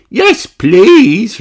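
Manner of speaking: high, loud eager reply; keen children answering; eager yes please. A narrator's reading. Peppa and Danny accepting Grampy's tales, eager high voices. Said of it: "reply Peppa and Danny".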